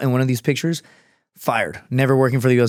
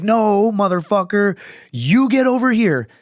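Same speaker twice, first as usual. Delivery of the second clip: high, slow defiant put-on voice; defiant refusal; 8 semitones higher, slower than usual. Refusing coworker, slow high mock defiance.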